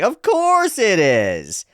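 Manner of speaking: high, drawn-out exclamation; overeager believer. Acted. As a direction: proclaim it with exaggerated gusto. Character eagerly claims a religion.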